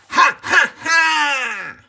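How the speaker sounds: very high, very loud evil cackle; witch cackle. A narrator's reading. Wicked Witch gloating over captured Dorothy, high shrieking cackle. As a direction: cackle in triumph. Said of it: "cried the Wicked Witch".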